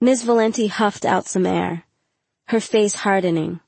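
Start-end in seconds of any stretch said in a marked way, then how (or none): none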